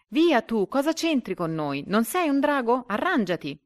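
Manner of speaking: high, loud, indignant outburst; angry shriek. A narrator's reading. Lead duck sends the kite off, shrieking angrily. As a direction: shout the intruder away. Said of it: "quando l'anatra lo vide strillò".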